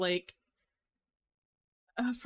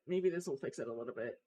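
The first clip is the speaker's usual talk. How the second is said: lower, softer, fast mumble; quieter repeat; 7 semitones lower, 8 dB quieter, much faster than usual. Real, not acted. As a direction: drop it to a low fast mumble.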